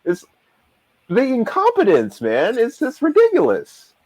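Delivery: real incredulous outburst, pitched very high; exasperated disbelief. Real, not acted. Fan ranting about the captains' incompetence, exasperated. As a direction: let exasperation send the voice way up.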